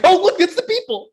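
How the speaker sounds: put-on high, loud childlike excitement; excited kid voice. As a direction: squeal it like a thrilled little kid. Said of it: "I was like".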